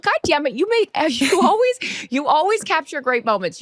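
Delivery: high-pitched mock scolding; retold yell. Reporter berating Steve over a missed picture, mock yell. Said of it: "I yelled at Steve".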